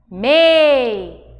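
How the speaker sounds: Mei held long and loud; stressed syllable. Shown as a demonstration. Teacher to class, stressed syllable drawn out. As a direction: lean into Mei and hold it. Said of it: "teacher stresses the syllable".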